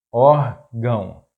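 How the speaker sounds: órgão slowly split, ór - gão; syllable by syllable. Shown as a demonstration. Teacher breaking down an example word, órgão slow in syllables.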